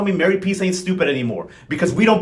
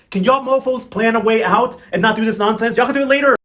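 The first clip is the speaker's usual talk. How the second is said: high, raised-voice scolding; fed-up rant at the characters; mad, exasperated; 5 semitones higher, 4 dB louder than usual. Real, not acted. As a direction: scold them, climbing higher and louder. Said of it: "y'all really wanted me mad today".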